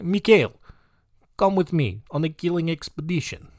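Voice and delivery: Mikhail called out in a hammed-up Russian accent; a cartoon Russian inviting a friend along; mock Russian accent. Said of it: "I've got the Russian guy now".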